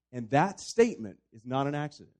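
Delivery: ordinary talk, nothing marked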